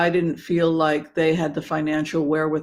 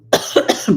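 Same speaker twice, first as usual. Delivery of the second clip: cough; 6 dB louder than usual. Real, not acted. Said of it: "broke my intermittent fasting".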